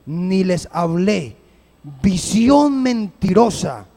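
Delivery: slow, weighty reading of the verse, every phrase set apart; slow solemn Bible reading from the pulpit; solemn scripture reading. Acted. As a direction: read the prophecy slowly, like a judgment. Reads Jeremiah aloud slowly.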